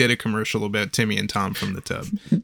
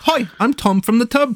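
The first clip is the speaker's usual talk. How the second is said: high-pitched mock-British sponge character; British cartoon character; 11 semitones higher than usual. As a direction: pipe up like an old British cartoon. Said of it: "this man wasn't British".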